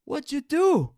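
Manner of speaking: put-on very high, insistent kid voice; amazed boy hollering questions; excited classmate. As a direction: call out eagerly, demanding answers. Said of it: "I hear Trevor say".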